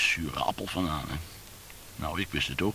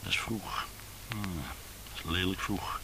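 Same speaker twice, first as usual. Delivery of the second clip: soft, half-whispered musing; 2 semitones higher, 6 dB quieter than usual. Acted. Man judging the hour to himself, soft muttering. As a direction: say it softly to yourself, dragging out the end.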